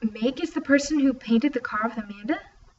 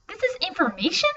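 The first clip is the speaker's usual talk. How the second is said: incredulous mocking; 7 semitones higher, 3 dB louder than usual. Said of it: "Nia insisted".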